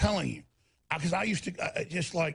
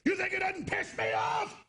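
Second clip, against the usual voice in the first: high, slow furious shouting at the TV stations; angry yell; 11 semitones higher, slower than usual. Retold or imitated. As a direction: bellow it like you're fed up with them. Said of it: "I go".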